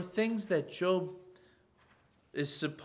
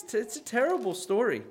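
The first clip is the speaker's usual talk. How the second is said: ordinary delivery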